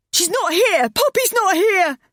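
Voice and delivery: high, loud terrified scream; panicked screaming. Acted. Mother discovers baby missing.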